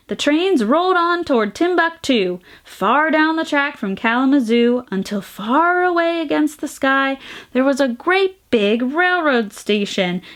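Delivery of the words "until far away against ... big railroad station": usual speaking voice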